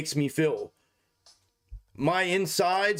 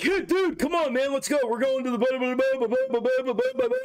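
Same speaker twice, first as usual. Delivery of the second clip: hyped-up buddy; 7 semitones higher than usual. Retold or imitated. Streamer mocks his buddy urging him out, high and eager. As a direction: lightly ham up a friend rushing you out the door. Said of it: "And then he's like".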